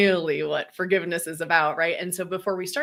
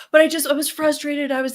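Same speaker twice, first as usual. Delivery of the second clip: mock excuse; 8 semitones higher, 4 dB louder, faster than usual. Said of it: "There's no".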